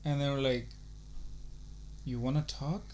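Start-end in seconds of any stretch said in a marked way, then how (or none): 2.0-2.8 creepy caller. Retold or imitated. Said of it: "In a very fucking low, creepy voice".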